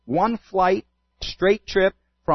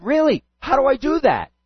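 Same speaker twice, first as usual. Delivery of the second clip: eager; 9 semitones higher than usual. Retold or imitated. Woman eager to be ready.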